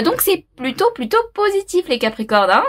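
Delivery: ordinary talk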